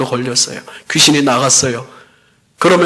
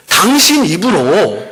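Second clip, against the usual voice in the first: ordinary talk, nothing marked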